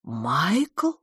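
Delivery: astonished rising question; gasped. A narrator's reading. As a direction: gasp the name, sliding upward in disbelief. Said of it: "ахнула мисс Петтигрю". (ахнув, с изумлённым вопросом)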